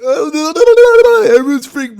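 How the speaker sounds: high, loud, slow stretched cry; hammed-up alarm; mock freak-out. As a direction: act out the panic. Mocking freaking-out audience.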